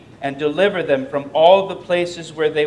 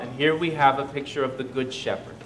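ordinary talk, nothing marked

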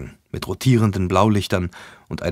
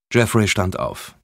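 ordinary delivery, nothing marked

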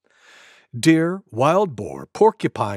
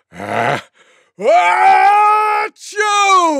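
loudest sneeze imaginable; 7 dB louder, much slower than usual. Acted. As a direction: blast a drawn-out mock sneeze. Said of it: "gave the loudest sneeze".

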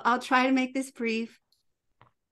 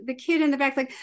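ordinary talk

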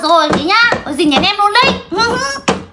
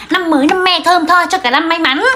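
normal talking voice

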